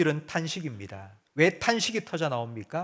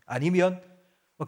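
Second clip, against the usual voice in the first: usual speaking voice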